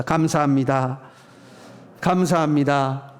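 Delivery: slow, drawn-out thanks; leading a thank-you. Shown as a demonstration. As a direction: model it for the congregation to repeat. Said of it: "우리 같이 합시다".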